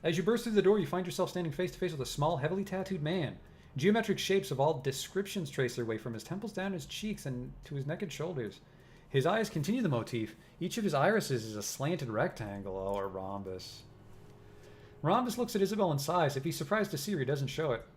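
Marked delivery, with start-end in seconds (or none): 0.0-12.8 game master sets the scene with a lively, raised voice; scene narration. A narrator's reading. 15.0-17.8 game master sets the scene with a lively, raised voice; scene narration. A narrator's reading.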